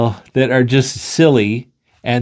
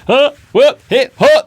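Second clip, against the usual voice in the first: loud, high staccato whoops; silly noises; 12 semitones higher, 5 dB louder than usual. Acted. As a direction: pop out playful random noises. All three join in making noises.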